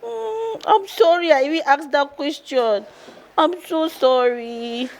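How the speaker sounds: high-pitched, pleading consolation; cooing sympathy. Real, not acted. Host begging crying guest to stop, cooing apology. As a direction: soothe them in a high, pitying coo.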